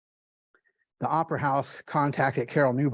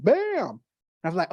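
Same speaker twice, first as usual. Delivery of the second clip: ordinary talk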